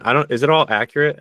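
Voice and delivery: ordinary delivery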